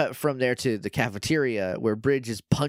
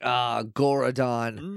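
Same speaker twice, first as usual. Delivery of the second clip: slow, ominous drawn-out name; dramatic realization; much slower than usual. Retold or imitated. Doggy hears the bad news from Kat, slow and ominous. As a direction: let the name sink in, slowly.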